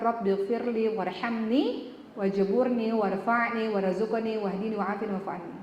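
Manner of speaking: slow, measured Arabic recitation; slow chanted Arabic prayer. Acted.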